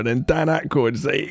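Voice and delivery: ordinary delivery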